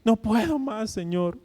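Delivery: high, drawn-out imitation of weeping prayer; mock tearful pleading. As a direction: plead in a hammed-up wail. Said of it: "de ruego y la gente llorando".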